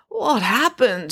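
groggy. A narrator's reading. Girl waking after a bang on her head, groggy. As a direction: come round confused, words stretched. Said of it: "she asked groggily".

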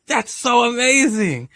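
high, slow gush of wonder; awed admiration. Retold or imitated. Retelling own wonder at God's design, high awed gush. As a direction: draw it out, marveling.

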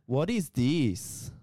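Persian accent. Shown as a demonstration. Showing friend how Persians speak English, exaggerated Persian accent. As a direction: stretch it out in a sing-song Persian accent. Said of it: "they got this weird accent".

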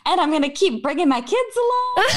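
high, singsong imitation of a determined friend; mock plucky resolve. Friend imitating her carrying on after so many hits, chirpy. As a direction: chirp it with teasing admiration.

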